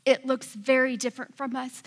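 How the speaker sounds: ordinary delivery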